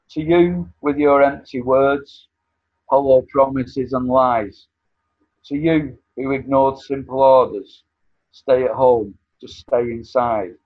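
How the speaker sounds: accusing spoken-word delivery; poem recitation. Acted. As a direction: recite it with pointed scorn.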